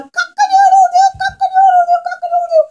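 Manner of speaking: very high, loud rooster crowing. Acted. Storyteller to children, imitating the rooster, high crowing. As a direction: crow it out like a rooster at dawn. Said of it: "the rooster would have gone".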